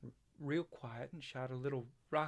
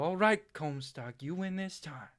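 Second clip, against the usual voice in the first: loud, higher playful give-up; mock surrender; 4 semitones higher, 7 dB louder than usual. Boy retelling how he conceded to his twin, mock surrender. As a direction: call out losing the game, hammed up. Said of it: "I have to go".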